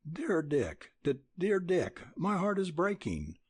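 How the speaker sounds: put-on a voice giving way, girl near tears; faltering. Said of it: "faltered Nell".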